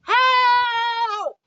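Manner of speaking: long, high, loud cry for help; drawn-out acted scream. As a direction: imitate a trapped child screaming. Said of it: "They might be screaming".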